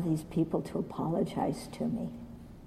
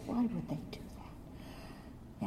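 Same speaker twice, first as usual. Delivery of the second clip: slow hushed wounded innocence; mock-innocent whisper; 15 dB quieter, slower than usual. Real, not acted. Teacher mocking her own self-pity, slow whisper. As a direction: whisper it, playing the poor victim. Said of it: "I'm so nice".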